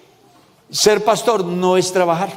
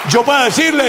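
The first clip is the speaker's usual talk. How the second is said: usual speaking voice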